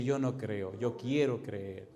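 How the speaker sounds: low and soft, imitating the caller; subdued confession. Atheist caller confessing to the pastor, subdued. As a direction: drop the voice low for a retold admission.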